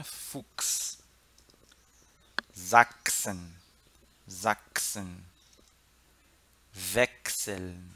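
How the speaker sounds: "Sachsen" and "Wechseln" low and measured, chs said "ks". Shown as a demonstration. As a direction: enunciate "Aufwachsen" with a hard k before the s. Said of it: "o C H S sempre X". ("Fuchs", "Sachsen" com chs soando X, de "ks")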